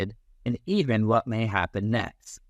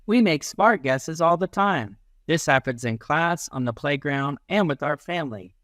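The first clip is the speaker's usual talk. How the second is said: ordinary delivery, nothing marked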